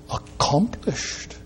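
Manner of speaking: breathy whisper after a pause. Acted. Preacher whispers the word to his congregation, hushed. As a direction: let the word fall to a whisper.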